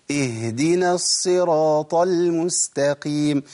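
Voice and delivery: very slow chanted "اهدنا" with a full, held h; fully articulated ha. Shown as a demonstration. Teacher models proper recitation, fully articulated ha. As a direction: recite "اهدنا" drawn out, voicing the h from its place. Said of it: "تاني".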